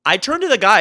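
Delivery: ordinary delivery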